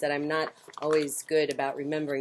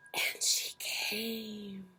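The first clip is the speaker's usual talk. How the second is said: hushed reveal; 3 semitones higher, 12 dB quieter, much slower than usual. A narrator's reading. Reader whispering to children as mother owl arrives. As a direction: gasp then whisper, very slow.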